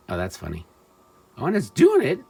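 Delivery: usual speaking voice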